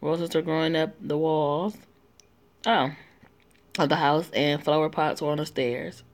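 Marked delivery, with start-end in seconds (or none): none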